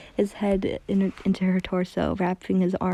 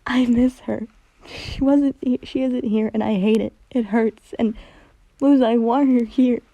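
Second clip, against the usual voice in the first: loud, higher, crying through the words; pained cry; 4 semitones higher, 7 dB louder than usual. A narrator's reading. Hunter sobbing to Luz about his loss, crying loudly. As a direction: grief breaking out of him. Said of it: "Hunter let out a pained cry".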